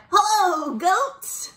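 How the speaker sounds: cheery high-pitched character hello; Duck. Acted. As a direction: call out hello in a high storybook voice. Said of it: "Duck said".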